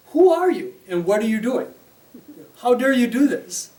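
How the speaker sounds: loud, high, outraged demand; indignant outburst. Retold or imitated. Rumi confronts the dervish, loud and outraged. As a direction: Rumi rounds on the stranger in shock. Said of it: "Rumi says".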